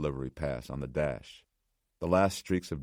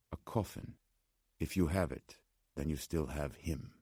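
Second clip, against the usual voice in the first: soft-voiced old man, Slavic accent; 6 dB quieter than usual. A narrator's reading. Old man explaining the coffin to Nora, soft Slavic accent. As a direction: repeat it softly, with a Slavic accent. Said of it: "The man spoke with an accent".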